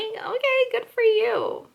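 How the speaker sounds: high mocking sing-song; fake-bright brush-off; sarcastic. Mocking reply to a bragging customer.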